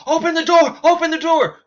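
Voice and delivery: very high, loud frantic scream. Acted. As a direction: scream it at the RV as you run.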